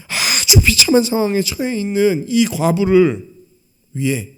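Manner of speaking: normal talking voice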